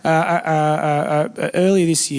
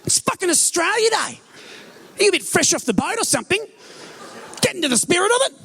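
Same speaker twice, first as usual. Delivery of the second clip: much higher, outraged bogan rant; hammed-up higher drunk indignation; indignant drunk bogan; 10 semitones higher than usual. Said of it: "And the guy said".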